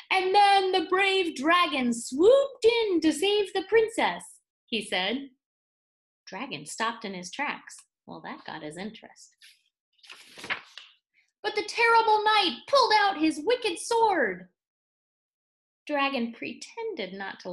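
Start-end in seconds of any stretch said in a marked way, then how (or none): 0.1-4.4 high, loud and slow storyteller boom. Acted. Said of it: "in a booming voice". 11.4-14.5 high and loud dramatic reading; booming voice. Acted. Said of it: "in a booming voice".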